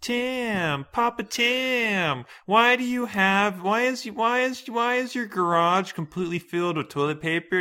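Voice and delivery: put-on high, loud little kid voice; squeaky whiny grandkid asking. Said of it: "we're gonna have some grandkids be like".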